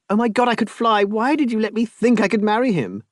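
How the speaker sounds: nearly flying with joy; elated. Acted. Marianne hugging Rob after escaping the marriage, elated. She flung her arms round him.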